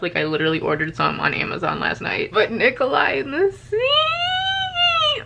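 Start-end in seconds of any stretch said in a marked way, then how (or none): 2.5-5.2 slow, very high swooning cry; swooning squeal. Real, not acted. Fan to viewers, swooning slow and high over Nikolai. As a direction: let it out like a fangirl shriek.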